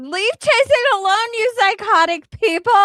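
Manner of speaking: high, loud mock yell; hammed-up shouted read. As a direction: shout the comment at the chat. Screamed reading of comment.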